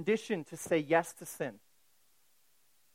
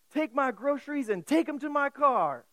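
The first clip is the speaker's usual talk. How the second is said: drill sergeant order; 8 semitones higher, 4 dB louder than usual. Retold or imitated. Sergeant orders cadet in store.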